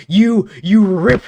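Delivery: hammed-up rage as she rips at the car; mock rage. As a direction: drag it out in pretend fury. Narrating road rage.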